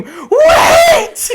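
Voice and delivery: high, loud, drawn-out scream. Retold or imitated. Late passenger to closing train doors, screaming. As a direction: scream it out desperately to hold the doors. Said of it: "I just scream".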